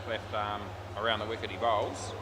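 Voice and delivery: ordinary talk, nothing marked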